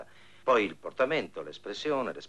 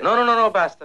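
much higher, loud cry of enough; loud refusal; 13 semitones higher, 12 dB louder than usual. Acted. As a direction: cut him off in alarm. Refuses to see more film. (rifiuto gridato e acuto)

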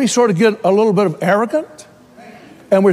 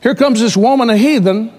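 ordinary delivery

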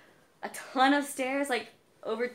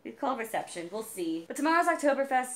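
ordinary talk, nothing marked

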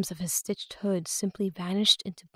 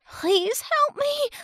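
put-on high, slow, pleading girl voice; terrified young girl; 6 dB louder, much slower than usual. A narrator's reading. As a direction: beg in fear, small and high. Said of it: "Romani whispered in terror".

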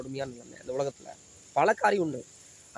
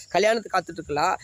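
ordinary talk

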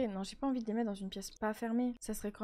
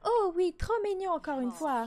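cooing; 5 semitones higher, 4 dB louder than usual. Real, not acted. Cooing at her baby in the game, high and sweet.

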